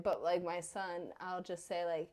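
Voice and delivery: ordinary talk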